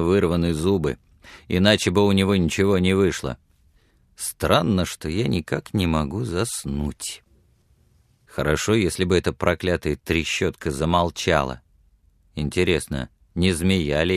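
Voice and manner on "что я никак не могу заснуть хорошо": usual speaking voice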